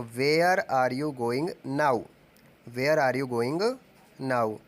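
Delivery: very slow, word by word for the class; slow dictation. Shown as a demonstration. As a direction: say it slowly so students can write. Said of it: "अब तुम कहाँ जा रहे हो?". (धीरे-धीरे वाक्य बोलना)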